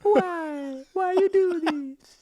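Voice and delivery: very high, drawn-out wailing Italian caricature; Paolo impression. Imitating House of Gucci character, high slow whining voice. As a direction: whine slowly in a squealing mock-Italian voice. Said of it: "he talks like this".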